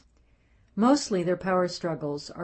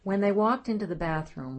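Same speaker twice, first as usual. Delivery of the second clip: usual speaking voice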